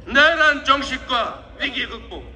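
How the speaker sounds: normal talking voice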